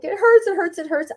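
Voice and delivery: high-pitched imitation of a hurting child; mock crying child. Daughter crying to mom about her scraped knee, mock wail. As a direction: plead like a kid in pain. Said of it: "she was crying and crying and crying".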